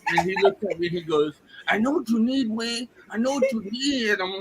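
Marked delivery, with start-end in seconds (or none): 1.7-4.1 higher-pitched pushy street hustler; Mexican street dealer. Retold or imitated. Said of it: "he looks like a fucking Juanito".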